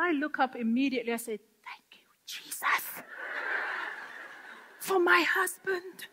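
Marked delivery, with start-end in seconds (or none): 1.6-6.1 slow, breathless whisper of gratitude; whispered thanks. Retold or imitated. Woman whispering thanks to Jesus for her husband. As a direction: murmur it upward in awe.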